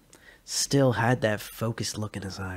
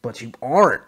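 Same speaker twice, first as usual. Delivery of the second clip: stern; 9 dB louder than usual. Acted. Joe retorts.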